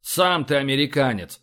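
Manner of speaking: ordinary delivery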